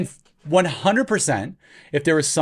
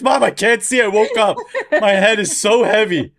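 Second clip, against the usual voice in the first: high, loud mock kid voice; complaining child; 6 semitones higher, 4 dB louder than usual. Kids calling to Mom with stone-covered heads, mock complaining. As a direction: wail like a hammed-up helpless kid. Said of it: "your kids".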